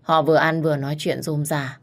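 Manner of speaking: usual speaking voice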